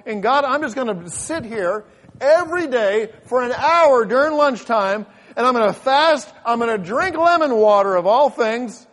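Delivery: loud, high retelling of a blunt prayer; impassioned preaching. Retold or imitated. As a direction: preach your old prayer out with force.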